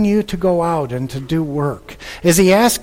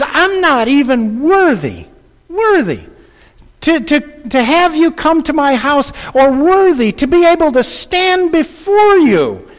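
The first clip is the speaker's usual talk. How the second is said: higher, louder pleading as the centurion; impassioned plea, pitched up and loud; 9 semitones higher, 5 dB louder than usual. Acted. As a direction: beg like a humbled soldier. Preacher voices centurion's plea.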